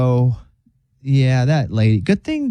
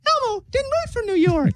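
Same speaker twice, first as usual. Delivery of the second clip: Elmo voice; 4 dB quieter than usual. Retold or imitated. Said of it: "mad at Elmo because".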